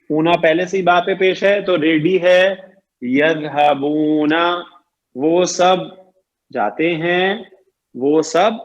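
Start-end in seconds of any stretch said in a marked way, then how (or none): 3.0-4.3 slow model word. Shown as a demonstration. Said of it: "teacher models the plural form".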